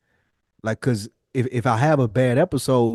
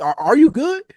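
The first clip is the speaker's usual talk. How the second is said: high, louder caring bro voice; pitched-up are you good from a homeboy; concerned check-in; 11 semitones higher, 5 dB louder than usual. Retold or imitated. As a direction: ask it like a worried friend leaning in. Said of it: "Yeah, like, you".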